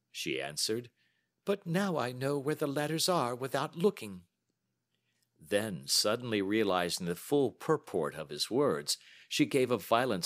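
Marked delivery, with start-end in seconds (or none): 1.4-4.3 higher-pitched earnest woman's voice; earnest lady client. Acted. Client answers the detective about her typewriting, earnestly. Narrator plays Miss Sutherland.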